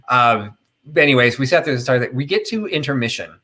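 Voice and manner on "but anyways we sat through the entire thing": normal talking voice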